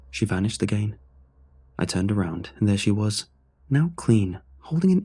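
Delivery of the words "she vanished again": usual speaking voice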